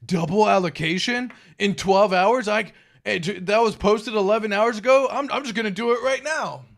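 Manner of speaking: mock panicked investor. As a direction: imitate a greedy investor rushing in. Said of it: "people are like".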